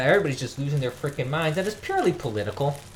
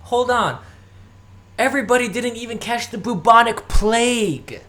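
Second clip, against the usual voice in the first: incredulous; 7 semitones higher, 4 dB louder than usual. Retold or imitated. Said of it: "I'm like".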